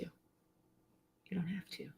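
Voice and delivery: slow, soft reassuring whisper. Real, not acted. Reassuring viewers they need no anger, whispered slowly. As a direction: breathe it gently, almost no voice.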